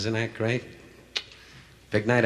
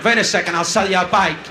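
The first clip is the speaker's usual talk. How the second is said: higher, pushy salesman voice; mock shopkeeper pitch; 6 semitones higher, 4 dB louder than usual. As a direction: hawk the bike like a pushy seller.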